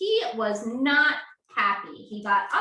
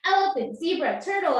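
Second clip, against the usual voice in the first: ordinary talk, nothing marked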